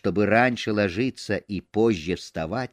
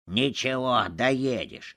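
plain reading voice

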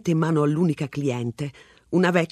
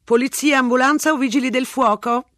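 higher, brisk switchboard operator; 9 semitones higher, 6 dB louder than usual. A narrator's reading. Emergency operator answering the call, brisk and higher. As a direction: routine emergency line greeting. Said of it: "chiese una voce di donna".

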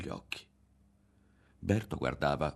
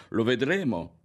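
loud, higher-pitched taunt; jeer, then warn him; raised, scornful menace; taunting threat; 5 semitones higher, 5 dB louder than usual. A narrator's reading. Berto taunts and threatens Ario.

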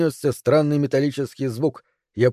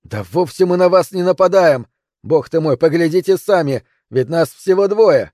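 higher, louder exasperated appeal; shouted protest; 4 semitones higher, 4 dB louder than usual. Acted. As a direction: yell up to make them see sense. Climber argues back at a distance. (возмущённо кричит наверх)

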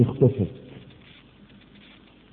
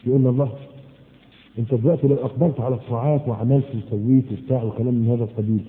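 ordinary talk, nothing marked

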